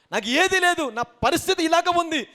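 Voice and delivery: high, loud acted lament; distressed complaint. Doubter lamenting having nothing, loudly desperate. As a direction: burst out with the hopeless complaint.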